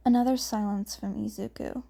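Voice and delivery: ordinary delivery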